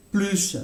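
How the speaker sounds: plus with a sounded s and a falling tune; "plus." ending on s, settled down. Shown as a demonstration. Teacher closing the demo: "plus." with the s. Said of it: "on peut entendre".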